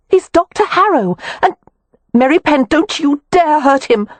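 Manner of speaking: high, loud urgent cry; frantic cry. A narrator's reading. Wynne crying out to Kev to spare the doctor. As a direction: shout it to stop him. Wynne stopping Kev's attack.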